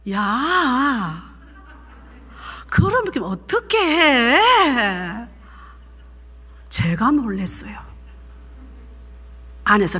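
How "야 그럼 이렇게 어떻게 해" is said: put-on calm correction of the child, slow; gentle restrained scold. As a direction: catch yourself and soften the yell. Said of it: "안에서 딱 당기는 거예요".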